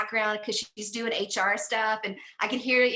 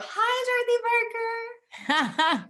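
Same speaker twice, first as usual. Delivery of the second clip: mock dog greeting; 12 semitones higher, 3 dB louder, much slower than usual. Greeting the dog in the other room.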